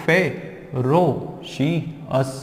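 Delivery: ferocious very slowly as fe, ro, shi, us; syllable by syllable. Shown as a demonstration. Teacher shows how to divide it, syllable by syllable. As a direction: break ferocious into four spaced beats. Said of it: "ऐसे break करना है".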